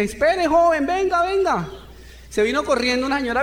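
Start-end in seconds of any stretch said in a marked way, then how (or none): 0.0-1.7 lightly voiced old lady calling out, higher. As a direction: call the young man back like a kind older woman. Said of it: "una señora gordita viene y me dice". (una señora mayor llamando, con voz algo más aguda)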